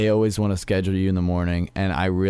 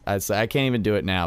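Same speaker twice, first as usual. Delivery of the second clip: normal talking voice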